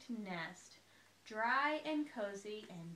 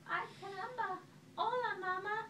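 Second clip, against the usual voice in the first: put-on high baby voice; very high baby chick voice; 10 semitones higher than usual. A narrator's reading. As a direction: squeak it like a newborn chick.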